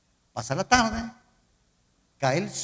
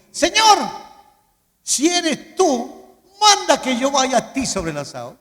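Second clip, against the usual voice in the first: loud, high, declaimed plea; calling out boldly; 7 semitones higher, 8 dB louder than usual. Retold or imitated. Peter calling to Jesus from the boat, bold shout. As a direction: call across the water, loud and emphatic.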